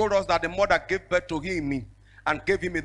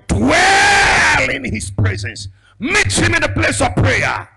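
shouted prophetic declaration, high and slow; sermon shout at the top of the voice; preaching at full force; 8 semitones higher, 7 dB louder, slower than usual. Acted. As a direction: proclaim it over them with full force.

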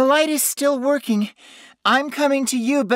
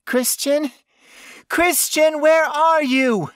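high, frightened calling, growing louder; whimpering panic; 7 dB louder than usual. A narrator's reading. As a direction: call out for him in terror. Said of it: "she whimpered".

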